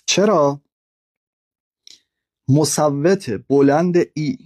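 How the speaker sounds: ordinary talk, nothing marked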